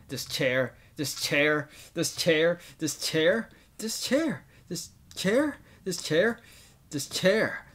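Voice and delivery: obsessive raging chant, punching each repeat; acted repetitive rage. Gamer voices a character mad at a chair, raging repetition. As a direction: hammer each repeat, pitch jumping about.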